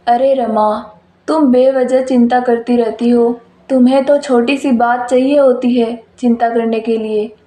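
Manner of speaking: a dismissive husband, spoken low; father's voice. Acted.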